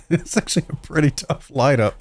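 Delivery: real chuckle breaking into the words; choked-up laugh. Real, not acted. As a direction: laugh through it, about to cough. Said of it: "got choked up about that lineup".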